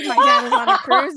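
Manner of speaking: shouted through a laugh; laughing mock yell; laughing mock scream. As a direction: yell it while cracking up. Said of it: "screamed at me".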